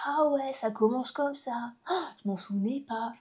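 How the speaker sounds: hushed, drawn-out mock amazement; softly play-acted wonder; retold astonishment. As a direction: act out slowly rediscovering something forgotten. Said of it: "je suis toujours étonnée à chaque fois".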